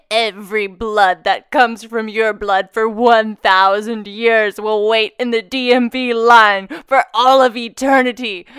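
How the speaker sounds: slow, loud cursing voice through laughter; laying a curse. Pretend. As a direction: lay a dramatic curse, slowly. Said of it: "I'm gonna say".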